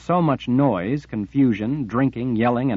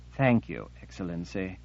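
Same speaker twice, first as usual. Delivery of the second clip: much softer frightened thanks, fading away; small voice; 2 semitones lower, 6 dB quieter than usual. Acted. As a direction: barely get the words out. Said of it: "managed in a small voice".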